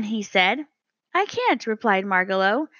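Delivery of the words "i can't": high, small bird voice, a little strained; a tiny bird with a sore throat; lightly voiced hoarse little bird. A narrator's reading. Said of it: "I have a sore throat".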